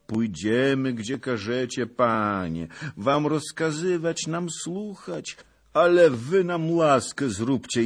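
high-pitched, submissive peasant voice; humble pleading. A narrator's reading. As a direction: coax the lord humbly. Old peasant submits and begs favour. (pokorna, błagalna prośba)

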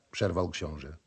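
usual speaking voice

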